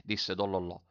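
usual speaking voice

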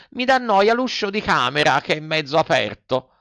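lightly voiced whiny puppet boy; fussy Pinocchio. Puppet invents another excuse for the fairy, fussing.

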